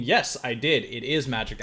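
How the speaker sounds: ordinary delivery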